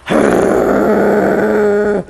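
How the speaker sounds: long, sustained rolling growl; threatening dog growl. Shown as a demonstration. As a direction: growl like a dog standing over another. Imitates dog standing over, confronting.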